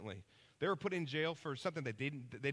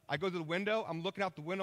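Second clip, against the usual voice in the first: usual speaking voice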